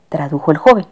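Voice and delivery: plain reading voice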